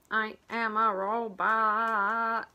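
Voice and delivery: slow, stiff robot voice; march out each word like a machine. Acted. Mommy and Daddy robots, slow robotic voice.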